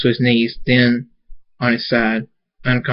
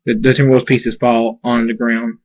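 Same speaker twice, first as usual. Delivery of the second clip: ordinary delivery, nothing marked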